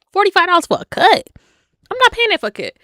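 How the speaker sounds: mock indignant squawk; outraged disbelief. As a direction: scoff at the price. Outraged at price.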